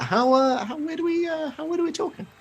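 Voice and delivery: very high, hesitant question; stammered, squeaky-high disbelief; wary querying. Acted. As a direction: stumble upward into a careful query. Stallholder queries odd order.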